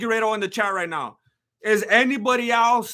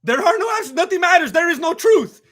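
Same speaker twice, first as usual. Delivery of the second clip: mock despair; 7 semitones higher, 5 dB louder than usual. Streamer mocking the fight result as meaningless, hammed-up proclamation. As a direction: voice raised high, louder, a hammed-up philosopher.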